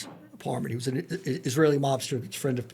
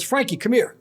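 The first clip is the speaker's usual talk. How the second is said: a called-out mock yell, pitched higher; 6 semitones higher, 4 dB louder than usual. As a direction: shout across the apartment to Frankie. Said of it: "he yells".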